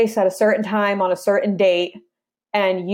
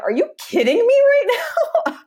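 much higher, laughing as she retells the detective's amazed outburst; thrilled disbelief; faster than usual. Retold or imitated. Detective calling back about the recorded call, laughing in delighted disbelief. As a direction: squeal it, half laughing, like you can't believe your luck.

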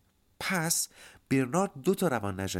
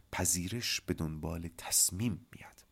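slow, softer, deliberate repeat; solemn maxim; 4 semitones lower, 5 dB quieter, slower than usual. Real, not acted. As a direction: lower and quieter, letting each key word land. Repeats maxim, likened to scripture.

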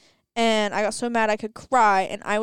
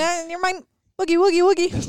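put-on silly baby talk; 7 semitones higher than usual. Silly baby-talk nonsense to friend.

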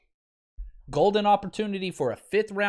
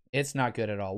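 softer resigned repeat; 7 semitones lower, 5 dB quieter than usual. Real, not acted. Host on injured lineman, lower and softer in resignation.

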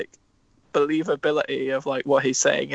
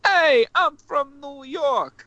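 hammed-up New York accent; 12 semitones higher, much slower than usual. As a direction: stretch it out in a broad, high mock New York accent. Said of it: "it's me doing a New York accent".

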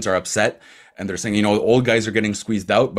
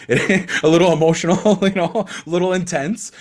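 laughing; 6 semitones higher than usual. Real, not acted. Laughing while teasing Habs fans.

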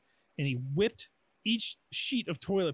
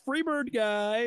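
showman; 8 semitones higher, 5 dB louder, slower than usual. Retold or imitated. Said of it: "He goes".

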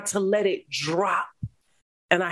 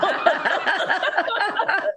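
bright burst of laughter, pitched up; high laugh; 13 semitones higher than usual. Real, not acted. Refusing God's gift in her retelling, a high burst of laughter.

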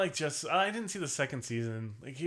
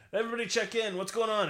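mock mobster; 8 semitones higher than usual. Quoting the dock scene mobster.

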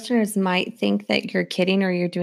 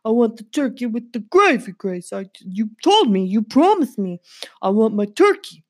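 louder, pushy mafia dog; gangster dog voice; 5 dB louder than usual. Pretend. Poodle Megan demands turkey and bacon from Grace, in a loud pushy voice. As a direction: make demands like a mob boss poodle.

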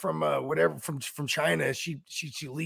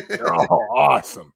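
comic reversal; 2 semitones lower, 6 dB louder than usual. Real, not acted. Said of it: "Same breath".

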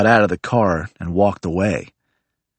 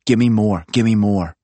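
greedy repeating; 3 semitones higher, slower than usual. Retold or imitated. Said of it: "He kept saying".

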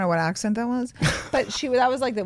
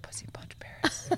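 voiceless, very soft whisper; retold whisper; 16 dB quieter than usual. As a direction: whisper it like a secret chant. Repeating friend's secret remark all night.

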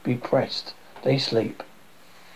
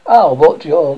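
loud rebuff; 8 dB louder than usual. Acted. Demetrius rebuffs pursuing Helena.

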